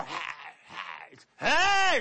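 high, loud shouted heys. Shown as a demonstration. Healed leper showing off his loud voice, high shouts. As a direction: shout it out with a brand new voice. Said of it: "So it's a loud voice".

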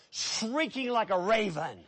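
usual speaking voice

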